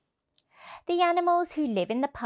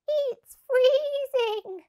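much higher, slow shivering rabbit; much slower than usual. Acted. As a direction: stretch out the cold words like a frozen rabbit. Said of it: "said one rabbit".

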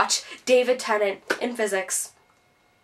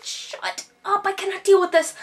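exasperated; 7 semitones higher than usual. Retold or imitated. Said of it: "I'm like".

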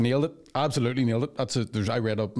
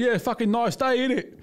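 put-on high-pitched matey English accent; chirpy fake English voice; 11 semitones higher than usual. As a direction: put on an English lad's small talk. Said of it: "convince my dad I was English".

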